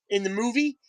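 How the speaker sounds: usual speaking voice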